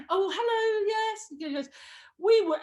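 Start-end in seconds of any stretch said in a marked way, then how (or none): 0.1-1.3 very high, affected telephone voice; put-on phone greeting. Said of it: "This is how I went". 2.2-2.6 loud, high American producer; imitated American accent. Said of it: "and she's American".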